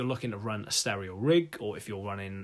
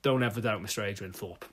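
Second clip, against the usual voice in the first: usual speaking voice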